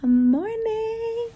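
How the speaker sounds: high sing-song greeting; cooing at a pet. Real, not acted. Waking the dog, baby talk.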